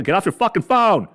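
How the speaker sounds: high mock yell at the phone; yelling at son. Father yelling at son to get off phone, mock yell. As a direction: snap at the kid glued to his phone. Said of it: "I'm fucking yelling".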